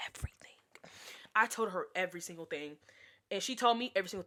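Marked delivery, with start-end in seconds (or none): 0.1-0.5 everything, breathed as a secret; whispered. Shown as a demonstration. Stressing they told each other everything, whispered. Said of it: "When I say everything, I mean".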